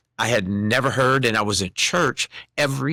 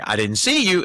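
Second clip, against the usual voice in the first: Miss Linda checking up; 7 semitones higher than usual. Retold or imitated. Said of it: "she would call me during the week and say".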